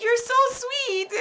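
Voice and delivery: touched patient thanking the kind doctor, gushing high. Retold or imitated. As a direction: squeal it like you're touched. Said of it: "I was like".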